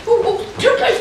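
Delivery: flustered giggling protest; a touch of laughing panic. Acted. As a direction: giggle and flap.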